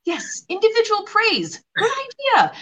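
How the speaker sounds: high, gushing encouragement; exaggerated, high-pitched praise; mock cheering on. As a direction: play an excited little cheerleader on the shoulder. Imagined coach on shoulder cheers client on.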